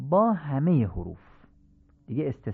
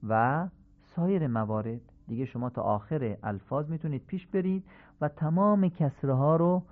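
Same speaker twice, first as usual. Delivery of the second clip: normal talking voice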